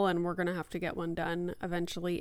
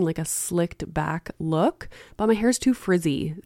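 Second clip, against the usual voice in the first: upbeat, loud sponsor read; 7 dB louder than usual. Acted. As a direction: sell it like a radio ad. Host reads sponsor ad.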